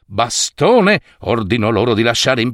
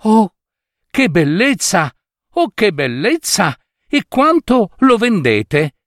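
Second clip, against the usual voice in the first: high, delighted gasp of wonder; a princess thrilled by the ring; 9 semitones higher than usual. A narrator's reading.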